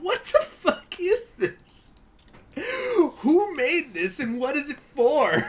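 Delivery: high, laughing, slowed-down incredulous cry; high giggling "what the fuck is this"; laughing disbelief. Retold or imitated. Retold own baffled reaction.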